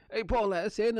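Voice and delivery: lightly voiced officer demanding entry; police officer. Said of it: "the police are saying".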